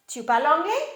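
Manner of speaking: "anglais" lifted into a question; rising question intonation. Shown as a demonstration. French teacher recapping the first method, rising intonation. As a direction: ask it by raising the end of "anglais". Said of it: "the first method is intonation".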